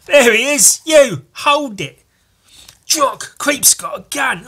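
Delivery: mock police shout; shouted cop lines. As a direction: yell it at the gunman. Dredd voiced shouting.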